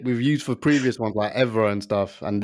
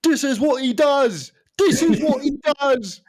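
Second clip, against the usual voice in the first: pundit impression; 13 semitones higher than usual. Pundit in the studio raving about Ronaldo. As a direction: gush like a TV pundit. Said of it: "the spoil was in the studio".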